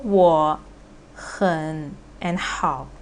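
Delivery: 我, 很, 好 each low and separate; all third tones. Shown as a demonstration. Teacher giving the unmodified example, slow low syllables. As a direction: sink each of 我, 很, 好 slowly. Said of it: "all of them is third tone".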